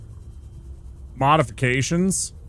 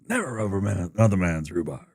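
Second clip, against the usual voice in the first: quoting Batman; 3 dB quieter, faster than usual. Retold or imitated. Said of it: "he says".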